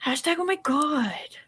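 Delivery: lightly voiced exasperated kid; kid talking to himself. Retelling what a boy said to himself in the theater, mock exasperated. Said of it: "to himself says".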